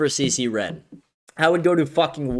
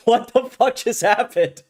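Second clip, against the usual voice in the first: groggy shock; 6 semitones higher than usual. Retold or imitated. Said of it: "you're like".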